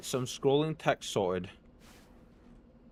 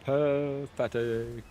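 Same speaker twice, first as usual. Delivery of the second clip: mocking; 3 semitones higher, much slower than usual. Real, not acted. Taunting enemies in game.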